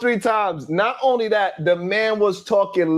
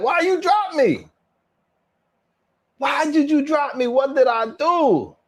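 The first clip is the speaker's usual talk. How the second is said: higher, wounded disbelief at being dropped; indignant protest; 7 semitones higher than usual. Real, not acted. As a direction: plead why they cut you off, genuinely baffled.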